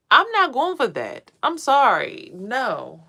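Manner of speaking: no way, said with force; emphatic refusal. Real, not acted.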